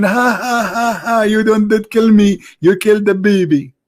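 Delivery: high, cackling gloat through laughter; mocking villain laugh. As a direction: laugh at the kid's killer like a smug action hero. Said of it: "he died laughing".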